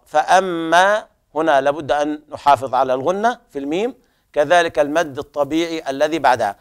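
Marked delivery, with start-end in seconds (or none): none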